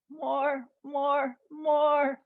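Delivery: high straining voice, building in volume; straining to stretch further. Shown as a demonstration. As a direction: push each word further into the stretch. Stretching hard while leading exercise.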